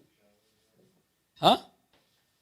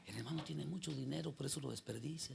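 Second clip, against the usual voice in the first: much softer, almost under the breath; quoted gossip; 4 semitones lower, 14 dB quieter than usual. Retold or imitated. Preacher imitating brethren gossiping about his money, hushed. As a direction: murmur it like gossip behind his back.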